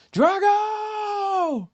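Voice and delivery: long high yelled name; shout. Retold or imitated. On top of the Rocky steps, a long high yell. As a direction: bellow the name to the skyline.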